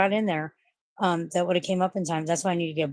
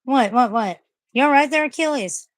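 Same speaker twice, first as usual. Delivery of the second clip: high, louder coaxing voice to the dog; concerned pet talk; 7 semitones higher, 4 dB louder than usual. Real, not acted. Owner asking her hot panting dog if he is okay, high pet talk. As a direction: check on him like a worried owner.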